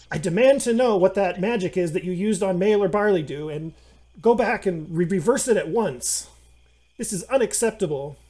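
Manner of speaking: loud, indignant confrontation; stern demand. Acted. GM voices Nanny Cowslip confronting gnome.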